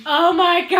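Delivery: loud, high mock panic; retold freak-out. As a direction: exclaim it as if flipping out. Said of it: "I was flipping out inside that room".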